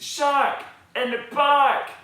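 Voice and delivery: loud, high shout of alarm; the boy shouts his warning again; fit to bust. Acted. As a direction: shout till you might burst. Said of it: "till he's fit to bust".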